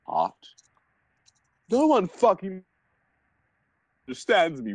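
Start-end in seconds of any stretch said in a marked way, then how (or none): 1.7-4.7 hammed-up angry shout. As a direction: wail it like a melodramatic goth. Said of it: "he shouted angrily".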